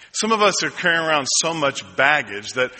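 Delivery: usual speaking voice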